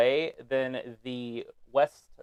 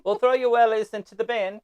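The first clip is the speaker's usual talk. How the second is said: put-on higher-pitched comic British accent; mock British accent; 7 semitones higher than usual. Friend joking with co-host about British slang, mock British accent. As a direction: ham up the British words playfully. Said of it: "how you guys make fun of us".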